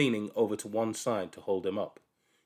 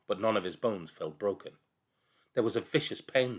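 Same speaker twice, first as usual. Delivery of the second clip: usual speaking voice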